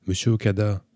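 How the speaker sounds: calling out. A narrator's reading. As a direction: call down to someone far below. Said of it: "appelait-elle".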